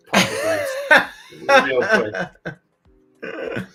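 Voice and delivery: breaking into laughter; laughing. Real, not acted. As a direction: laugh through the words. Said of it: "I was gonna just do a Canadian accent".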